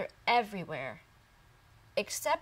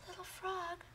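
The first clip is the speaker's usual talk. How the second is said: higher, gentle pig voice; kind little pig asking; 10 semitones higher, 7 dB quieter than usual. Acted. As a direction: lean in and ask the crying frog softly. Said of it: "Otis asked".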